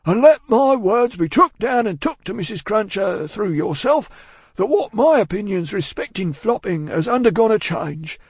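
loud, high Cockney speechifying; pulpit oratory. A narrator's reading. Cruncher preaching to Miss Pross, solemn oratory. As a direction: hold forth as if from a pulpit. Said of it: "hold forth as from a pulpit".

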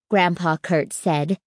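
plain reading voice

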